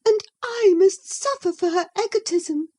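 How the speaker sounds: high, plaintive, a touch of sobbing; mild sobbing. Acted. Isabella alone with Nelly, mildly sobbing. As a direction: wail it out in self-pity. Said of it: "she sobbed".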